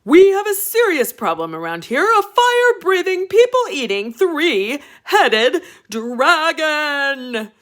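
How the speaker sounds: high, loud, slowing king voice; dramatic king. Acted. King warning his son, high loud dramatic build.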